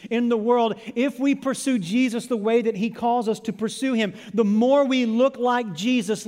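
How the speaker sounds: loud, high-pitched sermon delivery; full-force preaching. Real, not acted. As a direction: preach it hard, driving each phrase.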